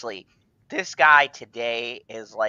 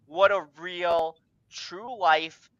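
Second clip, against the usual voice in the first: ordinary delivery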